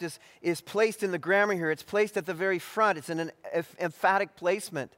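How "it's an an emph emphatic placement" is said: ordinary talk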